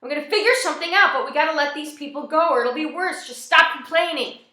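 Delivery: high, loud, overwrought voice; strained, high-pitched shouting near crying. A narrator's reading. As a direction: argue loudly as if close to tears. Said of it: "his voice gets higher and higher".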